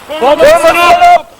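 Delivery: loud relayed command; shouted order. Real, not acted. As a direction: bellow it so the squad hears. Repeats order loudly to squad.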